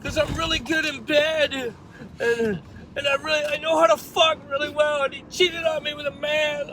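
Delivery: high, loud imitation of a distraught woman; hammed-up tearful rant; mock crying. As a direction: wail it as the crying jilted girl. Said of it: "crying her eyes out".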